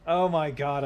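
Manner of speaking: sudden loud cry of dismay; loud dismayed exclamation. Real, not acted. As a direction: blurt it out loud in shock. Character suddenly dying in game.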